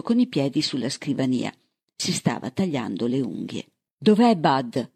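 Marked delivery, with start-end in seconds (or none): 4.0-4.9 urgent cry, only slightly raised; understated storybook shout; mild narrated shout. A narrator's reading. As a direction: call out the demand, held back. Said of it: "gridò Bird".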